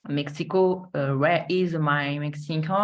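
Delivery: English in a French accent, drawn out slowly. Real, not acted. Presenter hunting for Mexico on screen, French-accented English. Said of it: "désolée pour mon accent".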